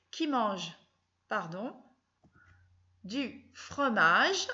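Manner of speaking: du fromage stretched out slowly; slow dictation. Shown as a demonstration. (dicter « du fromage » très lentement)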